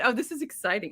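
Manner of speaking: ordinary delivery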